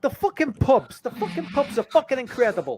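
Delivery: hammed-up Boston rant turning to praise; Bill Burr impression. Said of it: "Trying to do Bill Burr".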